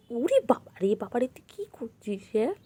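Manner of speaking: ordinary talk, nothing marked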